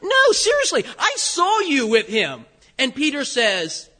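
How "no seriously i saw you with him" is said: high-pitched, loud insistence; acted incredulous accuser; insistent accusation. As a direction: jump up high as a bystander pressing the charge. Another accuser insisting to Peter.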